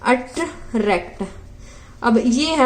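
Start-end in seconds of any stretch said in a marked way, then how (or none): 0.1-1.2 "attract" drawn out slowly, split before रैक्ट; stress on last syllable. Shown as a demonstration. Teacher gives an example of final stress, "attract" slowly split before रैक्ट. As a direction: say "attract" slowly in two parts, landing on रैक्ट. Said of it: "इनमें किस पे stress पड़ता है".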